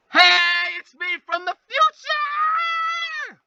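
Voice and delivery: high, loud, slow shouted intro; hammed-up announcement. Greeting viewers as his future self, high hammed shout. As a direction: bellow it like a grand reveal.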